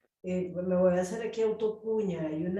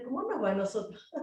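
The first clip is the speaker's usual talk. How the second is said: ordinary talk, nothing marked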